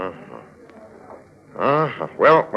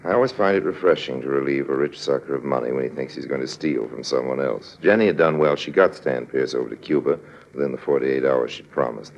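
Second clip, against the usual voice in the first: cool, knowing narrator voice; lightly voiced sly narrator, telling it to the audience; smug storyteller aside; story narration; 7 semitones lower than usual. A narrator's reading. Harry Lime narrates the scheme.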